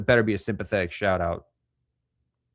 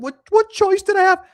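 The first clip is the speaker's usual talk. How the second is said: much higher and louder, a whiny squeal; mocking wife voice; 9 dB louder than usual. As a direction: squeal it like the exasperated wife making excuses. Mocking the wife's excuse.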